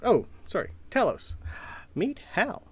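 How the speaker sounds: lightly voiced cheerful professor; a touch of a fussy inventor. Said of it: "and goes".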